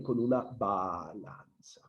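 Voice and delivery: drawn-out "balanza". Real, not acted. Teacher repeating the student's correct answer, drawn out slowly. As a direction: draw out BA-LAN-ZA slowly and quietly.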